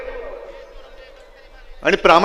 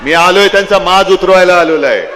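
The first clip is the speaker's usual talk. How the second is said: rally shout. Real, not acted. Rally climax against traitors.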